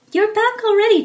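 surprised mother greeting her son; mother's voice. Acted. Mother greets Jack home, high and loud.

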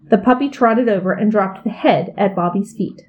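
ordinary delivery, nothing marked

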